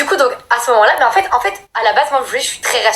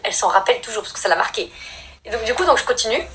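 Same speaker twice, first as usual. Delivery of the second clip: ordinary talk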